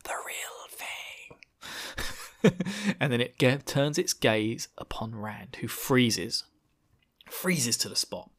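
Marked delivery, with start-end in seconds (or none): 0.0-2.7 very slow, breathy slogan; advert parody. Pretend. Villain enjoying a Coca-Cola, mock advert whisper. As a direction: sigh it out like a satisfied drinker.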